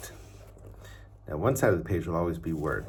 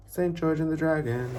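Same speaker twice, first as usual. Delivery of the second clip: title sung out much higher; playful high title; 11 semitones higher, 5 dB louder than usual. Shown as a demonstration. Teacher announcing the book title high and playful. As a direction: call the title out high and bright to the kids. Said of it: "And one more".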